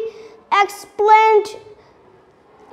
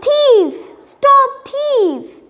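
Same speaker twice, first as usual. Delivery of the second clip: mild shout; 6 semitones higher, slower than usual. A narrator's reading. Rosie leaps up after the thief, mildly shouting. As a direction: call out an understated alarm at a thief.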